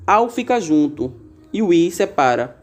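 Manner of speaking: ordinary talk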